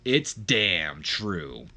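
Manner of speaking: drawn-out, very slow catchphrase; catchphrase in unison. Acted. Both brothers at the same exact time, slow catchphrase. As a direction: stretch every word right out. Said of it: "they both go".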